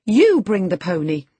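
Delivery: put-on high, loud girlish command; exclaimed order. A narrator's reading. Girl turning to the woman, imperious exclamation. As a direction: order the servant sharply. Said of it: "she exclaimed".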